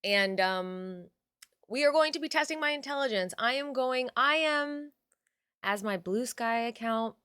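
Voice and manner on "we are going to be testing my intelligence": normal talking voice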